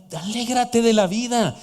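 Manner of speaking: slow, lifted, savoring "alégrate de la vida"; cheerful slowed reading; joyful. Shown as a demonstration. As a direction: invite them to rejoice. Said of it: "que significa".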